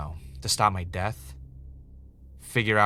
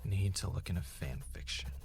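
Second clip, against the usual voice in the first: muttered note to self; 2 semitones lower, 11 dB quieter than usual. Acted.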